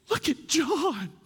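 awed gasp. Retold or imitated. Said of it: "And she goes".

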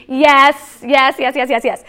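loud rapid-fire yeses, starting strongest; emphatic admission. Real, not acted. Mom owning up to nagging her kids, emphatic loud admission. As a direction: hammer out the repeated yes, then ease off. Emphatic answer to own question.

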